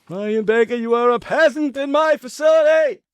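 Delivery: high, loud hammed-up proclamation; mock imperious shout. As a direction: bellow it like a pretend tyrant berating a lowly subject. Mock tirade at a colleague.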